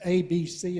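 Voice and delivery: "A, B, C" each loud and fully stressed; loud letter names. Shown as a demonstration. Teacher listing letter names, loud and stressed. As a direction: punch out "A", "B" and "C". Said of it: "loud and stressed".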